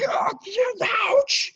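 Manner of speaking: very high shouted ouch; cried out. Acted. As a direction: yelp from a cut finger. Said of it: "cried out".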